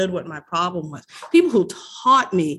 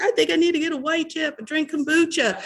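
panicked self; 9 semitones higher than usual. Retold or imitated. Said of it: "I really was like".

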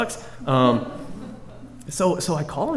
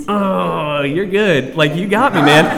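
caught out; 3 semitones higher, 8 dB louder, slower than usual. Retold or imitated. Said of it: "And I was like".

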